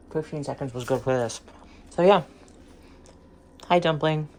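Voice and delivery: talking with food in mouth; mouth full. Real, not acted. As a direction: speak through a mouthful. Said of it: "You can hear me talking with food in my mouth".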